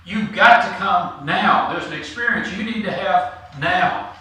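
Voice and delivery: loud, insistent stress on "now"; emphatic urging. Retold or imitated. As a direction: press the urgency, punching each "now". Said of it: "to emphasize".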